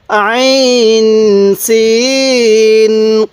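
আইন সিন held very long, কফ short and firm; elongated recitation. Shown as a demonstration. Teacher reciting for viewers, each letter very slowly drawn out. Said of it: "এরকমভাবে শিখব".

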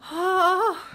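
loud, high "oh"; sudden gasping cry of alarm; startled cry. Real, not acted. Woman handling the mouse bowl, loud startled cry.

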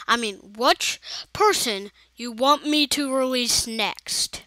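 ordinary talk, nothing marked

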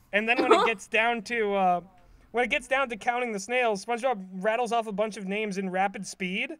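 usual speaking voice

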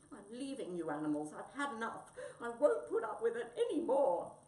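loud anger building to a high shout; furious cat reaching breaking point; fed-up outburst. Acted.

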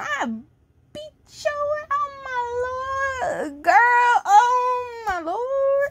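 high, slow hammed-up sobbing; mock crying wail. Reader fake-sobs at the villain, high and drawn out. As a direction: wail it like a melodramatic breakdown.

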